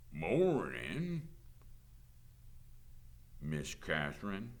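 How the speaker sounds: lazy, drawn-out greeting; drawling sheriff. A narrator's reading. Whiskey-drinking sheriff greeting the teacher, drawling. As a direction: greet her with an unhurried drawl.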